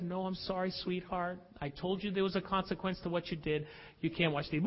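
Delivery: soft, low, gently firm refusal; mother calmly refusing her daughter. Retold or imitated. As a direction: say no softly but firmly.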